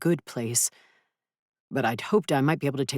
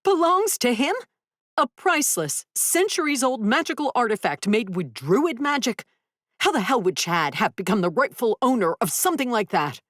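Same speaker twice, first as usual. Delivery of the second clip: furious high-pitched outrage; angry; 8 semitones higher than usual. Acted. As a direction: shout it in disbelief. Luna yelling at Duncan.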